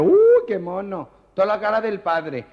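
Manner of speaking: high, loud, drawn-out cooing; gushing camp delight. Acted. Camp visitor gushing over the newborn, high, loud and drawn out. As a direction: coo over the baby, stretching every word.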